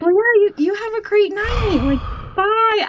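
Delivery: high, forced bright farewell with a sigh; fake cheery goodbye. Driver hurrying the creepy passenger out, forced cheer. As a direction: chirp a quick fake-friendly bye. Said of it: "I was like".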